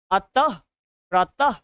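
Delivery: अतः, प्रतः each ending in h; visarga pronunciation. Shown as a demonstration. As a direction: pause between अतः and प्रतः, each closed on "ah". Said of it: "ऐसे बोलते हैं".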